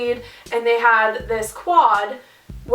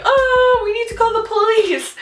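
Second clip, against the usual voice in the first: high-pitched mock panic; freaking out; 10 semitones higher than usual. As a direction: act out a wailing freak-out. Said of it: "So I'm freaking out".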